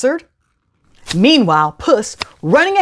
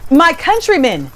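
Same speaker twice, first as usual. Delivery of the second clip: high, raised royal call; 8 semitones higher, 3 dB louder than usual. Acted. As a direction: call out to the crowd. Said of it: "the king called out".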